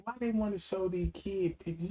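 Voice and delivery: normal talking voice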